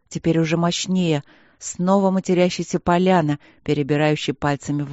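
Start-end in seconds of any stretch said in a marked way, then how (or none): none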